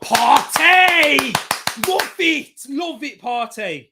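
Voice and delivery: high, loud, drawn-out cheer for the player; thrilled fan cheering a piece of skill; excited cheer. Real, not acted. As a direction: celebrate the moment at full voice.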